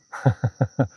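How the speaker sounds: short real chuckle; quick burst of startled amusement; amused laugh. Real, not acted. Meditation teacher after a bird flew across their head, amused laugh.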